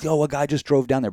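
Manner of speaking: mock yell for help; retold scream. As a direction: call out that someone crashed. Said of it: "I'm, like, screaming".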